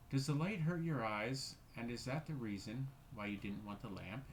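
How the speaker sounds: plain reading voice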